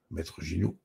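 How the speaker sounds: ordinary delivery, nothing marked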